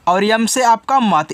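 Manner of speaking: ordinary talk, nothing marked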